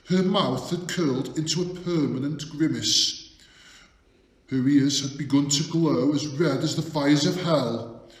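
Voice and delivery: plain reading voice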